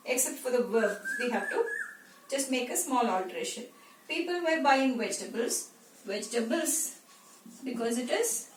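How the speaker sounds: usual speaking voice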